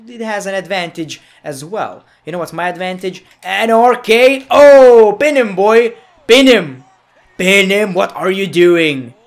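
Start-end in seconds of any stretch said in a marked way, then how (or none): 3.4-9.1 excited shouting. Real, not acted. Gamer urges wrestler to pin after finisher, loud shouting. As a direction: stretch the move name out, then yell orders.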